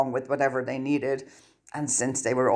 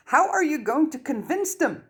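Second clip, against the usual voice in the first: much higher, frantic question; panicked; 12 semitones higher than usual. Acted. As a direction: push it out in rising panic. Hermione panicking.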